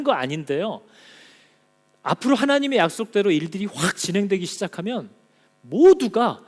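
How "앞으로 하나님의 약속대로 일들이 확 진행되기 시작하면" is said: ordinary talk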